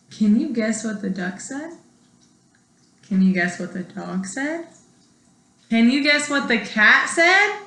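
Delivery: ordinary delivery, nothing marked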